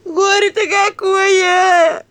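very high, long-drawn loud howl; keen it out at full cry; wailing. A narrator's reading. Mother laments daughter's disgrace, wailing loudly. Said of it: "Мать завыла белугой".

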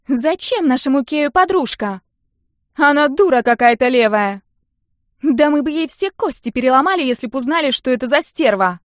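put-on high, furious jealous girls; angry fan girls. A narrator's reading. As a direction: spit out jealous outrage. Fangirls rage at girlfriend.

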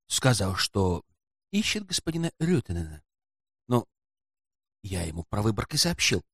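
quiet, sly porter striking a deal. A narrator's reading. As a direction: confide it quietly, for a price. Porter's sly low-voiced dealings.

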